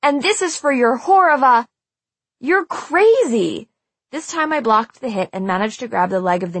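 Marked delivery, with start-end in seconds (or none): 0.0-1.7 high, loud furious accusation; shouting. A narrator's reading. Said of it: "Marcy shouted". 2.4-3.7 high, drawn-out outraged cry; exclaiming. A narrator's reading. Nora yells at attacker.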